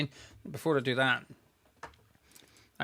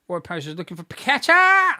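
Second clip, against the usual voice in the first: loud, rising live call; excited commentary; 5 semitones higher, 11 dB louder than usual. Real, not acted. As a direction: call the chance as it happens.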